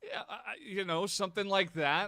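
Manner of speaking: high, loud sales pitch; used car salesman. Real, not acted. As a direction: push it like an eager salesman. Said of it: "you sound like a used car salesman".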